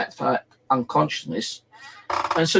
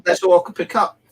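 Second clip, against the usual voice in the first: normal talking voice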